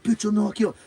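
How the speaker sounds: mock threat. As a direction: imitate a man squaring up for a fight. Retold threat between quarrelling passengers.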